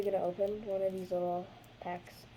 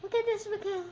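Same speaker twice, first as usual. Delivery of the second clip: excited; 13 semitones higher, 3 dB louder than usual. Real, not acted. Excited call to friend.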